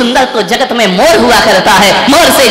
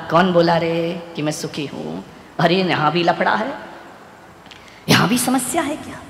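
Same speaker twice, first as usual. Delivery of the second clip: peacock sighing; 7 semitones lower, 12 dB quieter than usual. Acted. Peacock's weary lament.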